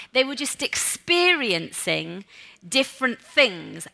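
normal talking voice

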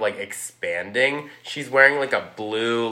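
normal talking voice

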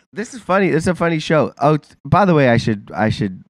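ordinary talk

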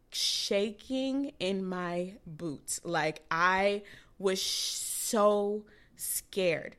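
very slow, stretched-out words; drawn-out emphasis. Retold or imitated. Mom recalling being scared of surgery, drawn-out emphasis. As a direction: drag each word out for dramatic weight.

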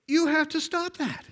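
high-pitched, sustained holler; mother calling out. Retold or imitated. Mother telling son to stop, calling out. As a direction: call it up the hill as an order.

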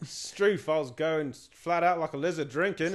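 loud, high, broad Aussie slang; broad Australian. Shown as a demonstration. Asked to say something in Australian, hammed-up Aussie accent.

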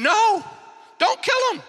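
high-pitched, acted alarmed command; urgent protest. David stops Abishai killing the anointed one, high urgent protest. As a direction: leap up high to stop him.

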